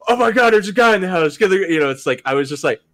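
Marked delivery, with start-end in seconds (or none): 0.1-1.4 higher, louder hammed-up shock; mock alarm. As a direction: imitate startled cops finding someone. Imagined cops startled at half-dressed man.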